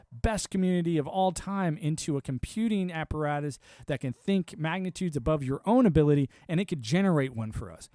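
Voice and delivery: usual speaking voice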